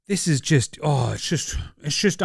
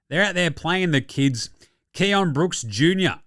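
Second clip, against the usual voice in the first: ordinary talk, nothing marked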